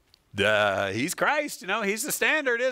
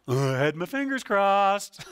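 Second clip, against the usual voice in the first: higher put-on kid voice; little kid; 4 semitones higher than usual. Little kid excusing a broken promise, childish put-on voice. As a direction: make a mock childish excuse. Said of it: "when, uh, you're a little kid".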